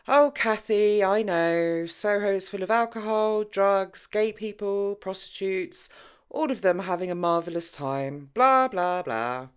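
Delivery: loud, high sarcastic dismissal; eye-rolling mockery. Jenny mocking Kathy's warning, loud and sarcastic. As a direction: brush off the worry with scorn. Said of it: "Jenny rolled her eyes".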